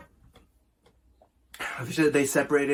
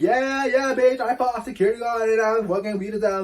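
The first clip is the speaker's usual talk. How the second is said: high, loud victory gloat; outside the club on the phone, bragging about the fight, high and loud; hyped bragging; 8 semitones higher, 4 dB louder than usual. Retold or imitated. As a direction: crow about it like you just won.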